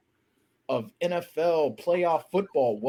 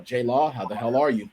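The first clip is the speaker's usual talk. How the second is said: ordinary delivery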